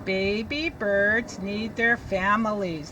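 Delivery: put-on slow, read it together with the kids; teacher leading choral reading. Shown as a demonstration. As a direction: lead the class line by line. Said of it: "You read it with me".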